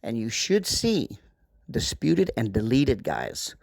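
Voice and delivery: walk them through each click; instructional voiceover. Acted. Screen walkthrough voiceover.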